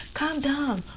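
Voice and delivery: high, mildly voiced official yelling; high-pitched mock command; mock shouting staff. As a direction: imitate the staff calling for calm. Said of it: "management are just shouting".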